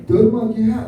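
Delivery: high, loud punchy cry; short rallying shout; rousing call. Retold or imitated. Preacher calling the crowd to give, loud rallying cry. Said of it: "Kalau dia kata".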